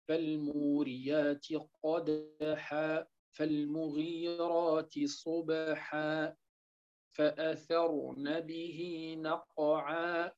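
slow, soft tajweed chanting; Quran recitation. Acted. Teacher recites surah al-Adiyat to students, slow soft chant. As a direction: recite the verses slowly and quietly.

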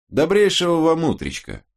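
exaggerated Irish brogue, pitched high; Irish accent. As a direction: ham up a thick Irish lilt. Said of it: "гипертрофированно имитируя ирландский акцент". (утрированный ирландский акцент)

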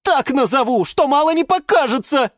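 high-pitched threatening snap; angry bark. A narrator's reading. Geranium threatens the lemon, barking angrily. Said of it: "рявкнула на него герань Антонина".

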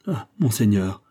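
ordinary delivery, nothing marked

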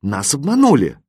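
plain reading voice

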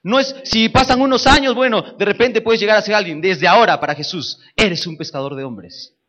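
loud, high, rousing sermon; preaching. Acted. Preacher rousing his church, loud and high. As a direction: preach it out to the congregation.

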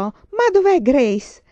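loud, high, slow frightened call; sudden alarmed shout. A narrator's reading. Laura suddenly shouts for missing Grace, loud and alarmed. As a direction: shout it out, suddenly scared for the child. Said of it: "e all'improvviso gridò".

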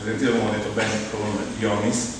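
normal talking voice

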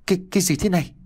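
shocked stammer. Acted. Father sees possessed wife.

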